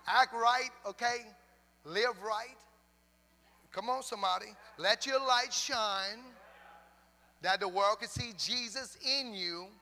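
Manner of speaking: loud, drawn-out church exhortation; preaching. Real, not acted. Preaches to the congregation.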